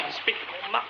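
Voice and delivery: ordinary delivery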